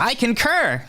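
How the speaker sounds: high prim robot voice; Will voice. Acted. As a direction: pitch way up, clipped and formal. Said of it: "And Will goes".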